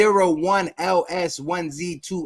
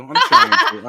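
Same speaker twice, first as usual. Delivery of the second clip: much higher, louder, laughing; laughing squeak; 8 dB louder than usual. Real, not acted.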